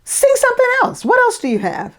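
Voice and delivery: high, pushy mock director; impatient director. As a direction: toss it off like a bored boss. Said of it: "it's like".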